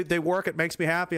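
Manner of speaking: normal talking voice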